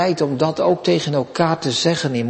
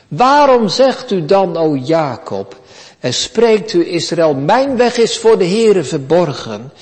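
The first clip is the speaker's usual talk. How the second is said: forceful scripture reading; 4 semitones higher, 4 dB louder than usual. Acted.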